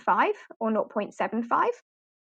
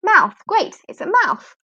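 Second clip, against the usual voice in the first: higher, animated classroom exchange; bright teacher-and-student demo; 8 semitones higher, 4 dB louder, slower than usual. Shown as a demonstration. Teacher acting out a quick quiz with a child, higher and lively. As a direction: act both the teacher asking and the child answering. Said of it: "and say".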